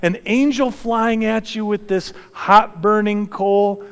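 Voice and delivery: ordinary delivery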